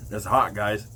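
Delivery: ordinary talk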